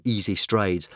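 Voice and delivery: usual speaking voice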